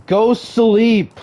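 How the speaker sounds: exasperated loud plea; mock stern order. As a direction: call it out loud and high, like scolding. Streamer orders chat to sleep.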